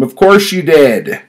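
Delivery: high, loud furious retort; angry shout. Retold or imitated. Narrator shouting at the old man who botched the card payment, loud and high. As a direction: snap it back at him, fed up. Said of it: "I shouted at him".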